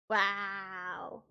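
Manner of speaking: usual speaking voice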